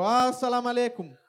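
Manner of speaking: high, loud copy of a salaam; hailing greeting. Retold or imitated. Interpreter copying the greeting to the baker, loud hail. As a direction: echo the called-out greeting.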